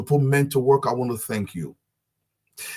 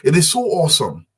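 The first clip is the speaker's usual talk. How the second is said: "awesome" lifted higher and louder, leaning on "so"; stress on awesome; 4 semitones higher, 5 dB louder than usual. Shown as a demonstration. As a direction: hit "so awesome" hard and high. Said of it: "let me stress that word awesome".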